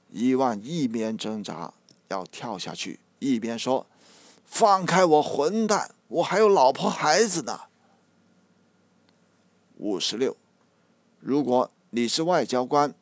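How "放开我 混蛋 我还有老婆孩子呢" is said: higher, louder, strained struggling shout, 有 stressed with a falling tone; desperate yell. A narrator's reading. Ivan struggling in Carter's arms, desperate yell. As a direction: fight free and yell the line.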